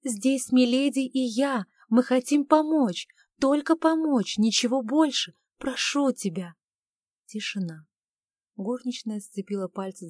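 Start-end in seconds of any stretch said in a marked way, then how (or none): 0.0-6.5 urgent, high pleading through a door; desperate pleading. Acted. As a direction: beg her to open up, frightened. Maid pleads at locked door.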